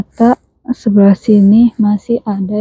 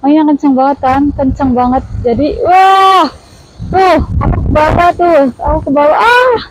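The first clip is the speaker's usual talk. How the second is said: raised high voice against wind; shouting over wind; 6 semitones higher than usual. Real, not acted. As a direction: call out over gusts. Strong wind on the hilltop.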